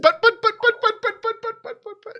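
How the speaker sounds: very high but-but, fading away; fading squeak. Acted. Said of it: "sort of fades out of".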